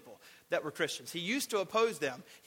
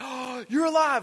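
higher and louder, a mock startled cry; amazed disciples; 6 semitones higher, 7 dB louder than usual. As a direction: gasp it out like you can't believe he lives. Disciples see Paul wake up.

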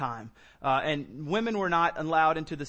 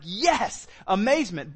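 amazement; 3 semitones higher, 4 dB louder, slower than usual. Acted. Someone prayed for finally meets Christ, thrilled amazement. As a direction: cry it out as if thrilled. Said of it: "there's a sense of".

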